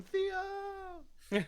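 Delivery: high, drawn-out mock yell through laughter; imitated yell. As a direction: call the name out high, cracking up.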